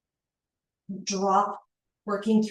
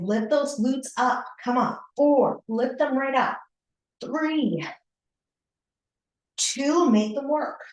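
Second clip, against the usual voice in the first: higher and punchier urging through the count; energetic coach push; 5 semitones higher than usual. Acted. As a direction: pump the class up for the final reps. Coach pushing the last reps.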